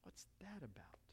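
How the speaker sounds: very soft, whispered question; puzzled whisper. Acted. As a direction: whisper it as a baffled disciple. Voices the disciples' puzzled reaction.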